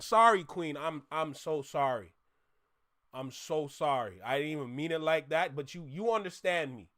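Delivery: soft, low, sincere apology; apologetic. Real, not acted. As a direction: drop the volume and apologise gently. Apologising to Queen for Englishman jab.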